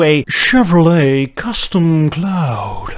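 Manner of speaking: drawn-out, slow name reveal; grand reveal. Acted. Host unveiling a custom car name, grand and drawn-out. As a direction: stretch it out like a luxury unveiling.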